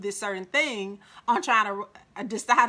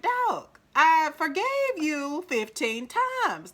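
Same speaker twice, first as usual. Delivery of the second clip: high, loud, drawn-out exasperation; fed-up acted complaint, high and slow; exasperated confrontation; 7 semitones higher, 4 dB louder, slower than usual. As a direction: call him out, pitched up and stretched slow. Confronting a lying boyfriend, acted.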